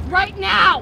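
real loud, insistent order; angry demand. Real, not acted. Woman insisting the recording be deleted, angry demand. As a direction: hit it hard as a final order.

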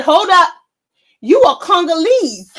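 retelling the man shutting Joy-Ann Reid down, hammed-up loud; mock shout. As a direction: belt the quote out to top the story. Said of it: "He said".

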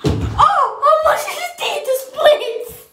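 high, loud startled cry. Real, not acted. Nearly falling while filming, a startled yelp. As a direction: yelp as if slipping. Slipping mid-routine.

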